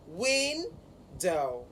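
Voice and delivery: "window" slowly split, WIN held, then dow falling; first-syllable stress. Shown as a demonstration. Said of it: "the stress is on the first syllable".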